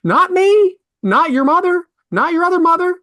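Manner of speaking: high, loud, rising protests; indignant. Acted. Father challenging his daughter's pronunciation, indignant. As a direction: list them in high-pitched indignation.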